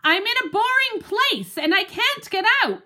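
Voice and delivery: high, loud doggy complaint; barking plea. Acted. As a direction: bark it out to be heard. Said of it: "I bark and bark".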